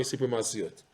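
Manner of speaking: ordinary delivery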